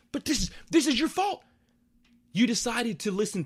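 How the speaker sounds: high-pitched scolding; exasperated blame. Acted. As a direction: squeal the accusation in exasperation. Scolding the character as if present.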